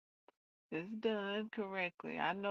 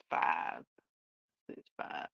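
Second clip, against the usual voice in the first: whispered stitch count; whispering; 12 semitones lower than usual. Real, not acted. Counting stitches under breath.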